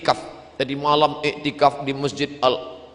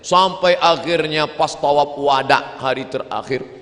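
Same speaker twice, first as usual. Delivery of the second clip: usual speaking voice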